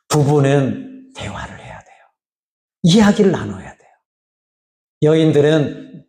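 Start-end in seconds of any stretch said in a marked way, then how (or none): none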